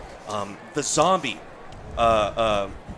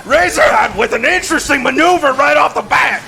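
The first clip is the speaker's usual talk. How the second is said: loud, high announcer call broken by laughter; laughing announcer; 10 semitones higher, 8 dB louder than usual. Acted. Announcer reacting to Razorhide's arm rip, loud and laughing. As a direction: call the move while cracking up.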